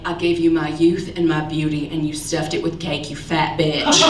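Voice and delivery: low, cold inner voice of grandma; grandmother's eyes speaking. Retold or imitated. Comic voicing grandma's silent judgment, low and cold. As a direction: drop low and let the insult bite. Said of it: "but your eyes are saying".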